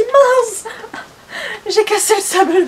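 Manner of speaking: high, loud panicked exclamation; sudden fright that it broke. Real, not acted. Panics, thinks she broke it.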